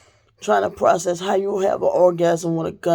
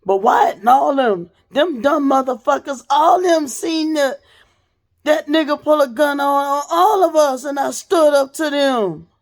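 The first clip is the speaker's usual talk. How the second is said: heated rant; 7 semitones higher than usual. Real, not acted. Telling viewers about witnesses who saw the gun, heated higher rant. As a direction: vent hard about the people who doubted her.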